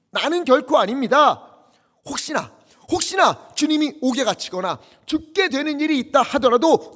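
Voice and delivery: loud, high, fervent preaching; raising the voice over the congregation; impassioned preaching. Acted. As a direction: preach Peter's vow with full force. Preacher quoting Peter's vow.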